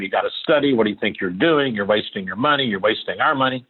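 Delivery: usual speaking voice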